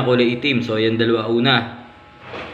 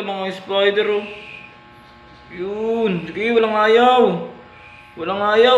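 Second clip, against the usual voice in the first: excited play-by-play; 7 semitones higher, slower than usual. Real, not acted. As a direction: cheer the spiders on, getting carried away. Spider fight climax. (masiglang pagko-komentaryo sa laban)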